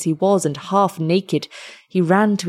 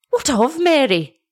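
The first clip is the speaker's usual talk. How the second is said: higher, frightened cry of a mother; terrified mother; 8 semitones higher than usual. Acted. Mother fears for her drowned daughter, high frightened cry. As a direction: let terror push the voice up. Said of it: "cried her mother, shuddering and groaning with terror".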